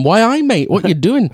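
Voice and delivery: high, hammed-up Geordie greeting; Geordie accent. Imagined British director challenging actor on set, bad Geordie accent. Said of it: "That's not a Geordie accent either".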